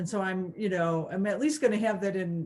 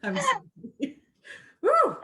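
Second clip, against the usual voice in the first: much higher, laughing through an apology; 5 dB louder, slower than usual. Real, not acted. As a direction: apologize while the laughter takes over. Laughing after emotional outburst.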